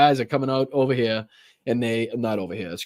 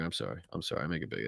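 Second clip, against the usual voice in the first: much softer, half to himself; quiet apology; 6 semitones lower, 13 dB quieter, much faster than usual. Real, not acted. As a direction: mumble sorry while fixing the screen. Fumbling with screen, apologizing.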